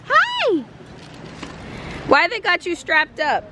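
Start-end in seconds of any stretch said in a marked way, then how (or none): 0.0-0.6 put-on baby talk. Greeting baby in cute voice.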